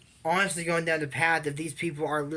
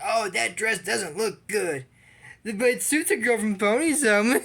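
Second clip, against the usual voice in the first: high, snooty put-down voice; mock the spoiled girl's sneer; rising haughty tone, louder at the end; snobby mock; 6 semitones higher, 4 dB louder than usual. Mimicking snobby Eliza.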